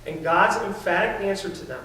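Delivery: normal talking voice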